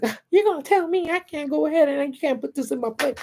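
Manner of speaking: very high squeaky imitation; mock indignant woman. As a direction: mimic her outrage way up high. Mimics indignant woman passenger.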